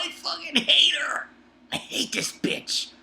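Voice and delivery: whispered venom, slowing on the second line; hissed hatred. Real, not acted. Reviewer venting about the villain Seiryu, hissed. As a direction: spit it out in a furious whisper.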